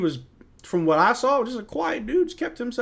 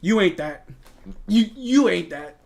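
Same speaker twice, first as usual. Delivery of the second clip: lightly voiced trash talk; a hint of taunting a rival; mild mock taunt at Quentin Grimes; imitating Scotty Barnes; 4 semitones higher, 4 dB louder, slower than usual. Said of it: "When Scotty said that to".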